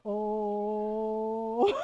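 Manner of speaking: long, low drawn-out oh; wincing oh. Retold or imitated. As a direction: hold a low oh in sympathy. Reacts to the aunts' roast.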